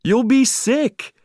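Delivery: high, loud and slow warning cry; alarmed shout. A narrator's reading. Owner warning Marley off the seawater, high loud cry. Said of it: "I cried".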